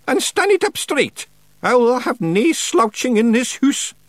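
high, commanding witch voice; stern old witch. Acted. Witch orders Feegles to attention, stern. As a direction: order them about in high Scots-tinged tones.

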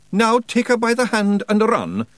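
high, loud Scots order; urgent Feegle command; Scottish Feegle voice. Acted. Rob Anybody speaking.

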